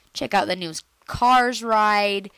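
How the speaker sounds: ordinary talk, nothing marked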